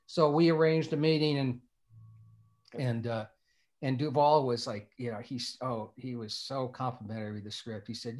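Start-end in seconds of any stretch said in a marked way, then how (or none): none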